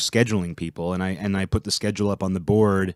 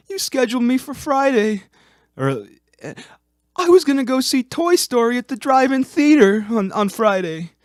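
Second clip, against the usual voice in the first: put-on very high complaining old man; squeaky grumbling complaint; whiny high voice; 5 dB louder than usual. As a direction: whine it up high like a put-upon employee. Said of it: "This is actually how he sounded".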